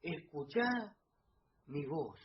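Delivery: almost a murmur, God calling gently; voz de Dios susurrante; hushed voice of God. Shown as a demonstration.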